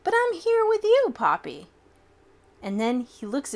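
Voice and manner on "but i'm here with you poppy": put-on child's reply. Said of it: "And I say".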